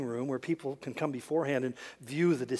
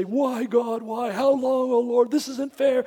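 higher, louder whine at God; whining complaint; 7 semitones higher, 7 dB louder, slower than usual. Retold or imitated. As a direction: gripe like a wronged child. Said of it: "complain and gripe and whine".

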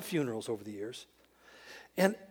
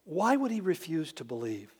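normal talking voice

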